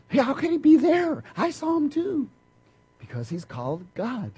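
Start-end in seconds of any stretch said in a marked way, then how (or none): 0.1-2.3 high, incredulous imitated voice; mock puzzled person. Preacher imitating a puzzled believer, mock high. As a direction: play a baffled churchgoer, pitched up. People voiced arguing about Jesus.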